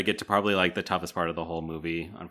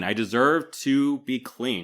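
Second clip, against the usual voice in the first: slower, heated insistence on "deserve", louder and higher; fired up, drawn out; 6 semitones higher, 4 dB louder, slower than usual. Retold or imitated. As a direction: lean hard on "deserve" and stretch the line slowly. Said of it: "she's fired up here".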